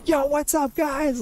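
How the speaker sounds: high, loud hyper-energetic holler; imitated hyper screaming. Streamer imitating a hyper shoutcaster greeting viewers, screaming. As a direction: scream it like an over-amped shoutcaster. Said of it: "like, screaming the whole time".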